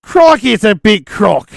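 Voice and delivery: light, excited Aussie croc-hunter voice; Steve Irwin. Acted. Host riffing on the crocodile answer, light Steve Irwin imitation. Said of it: "bootleg Steve Irwin".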